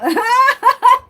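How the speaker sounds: big high laugh out loud; loud burst of laughter. Real, not acted. Laughing out loud at a viewer in chat. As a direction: crack up loudly at the viewer.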